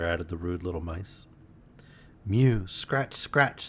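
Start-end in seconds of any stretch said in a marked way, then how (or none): 2.3-3.7 cat voice mewing and scratching; scuffling cat. A narrator's reading. Simpkin on the windowsill scuffles to get in, cat-voiced. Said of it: "scuffled Simpkin on the windowsill".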